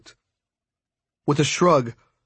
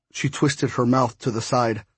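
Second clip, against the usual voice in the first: usual speaking voice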